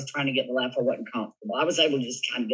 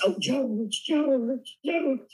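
swooning; 10 semitones higher, much slower than usual. Retold or imitated. Swooning over Clooney crush.

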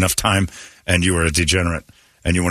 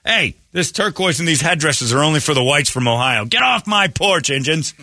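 Gilbert Ortega shouting; 6 semitones higher than usual. Retold or imitated. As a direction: bark them off the porch. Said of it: "Boy, that Gilbert Ortega just".

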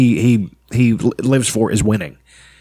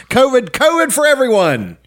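mock gleeful giveaway; 10 semitones higher than usual.

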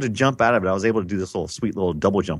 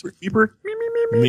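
very high chirpy nonsense squeak; high squeaky voice. Retold or imitated. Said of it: "he was high pitched".

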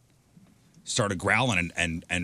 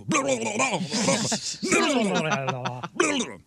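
high babbled mock scolding; dog hearing gibberish; 12 semitones higher than usual. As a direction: babble nonsense as the dog hears it. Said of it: "that's why with the dog's thinking".

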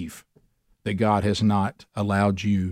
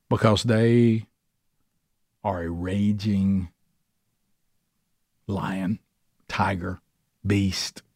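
slow dramatic build; 3 semitones lower, much slower than usual. Acted. Slow dramatic list of beasts.

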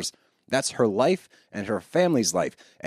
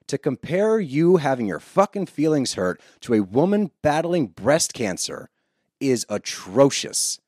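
ordinary delivery

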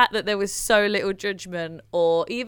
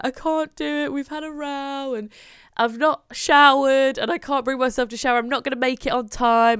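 higher, upset retelling of tears; retold crying; 5 semitones higher, 5 dB louder than usual. Mum retelling crying call to friend, upset higher voice. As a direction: replay the panicked tearful call. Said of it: "and I was crying".

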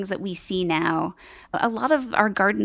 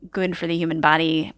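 ordinary talk, nothing marked

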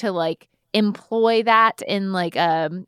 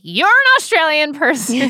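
higher, louder mock exclamation; louder, higher, playful announcement; acting out a realization; 7 semitones higher, 7 dB louder than usual. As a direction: blurt it out like a sudden discovery. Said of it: "you're like".

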